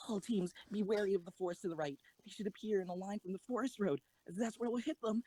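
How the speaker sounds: fast, firm commands; brisk orders. Acted. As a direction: rattle off the plan decisively. Commander giving battle orders.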